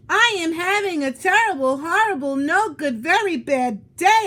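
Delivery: loud, high wail of complaint; shouting. Acted. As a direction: yell it at everybody, fed up. Child yells at family in car.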